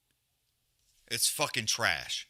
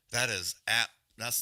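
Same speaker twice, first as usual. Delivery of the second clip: normal talking voice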